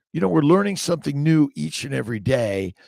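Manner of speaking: ordinary delivery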